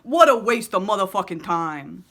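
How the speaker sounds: enraged inner voice. Acted. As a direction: explode at the other two voices. Frustrated inner voice ranting.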